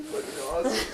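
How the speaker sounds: high-pitched, through laughter; laughing delight. Real, not acted. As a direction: say it while cracking up. Laughing over the prank.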